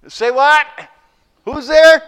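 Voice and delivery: high, loud startled shout; surprised. Acted. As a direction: shout up in shock. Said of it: "he's a little surprised".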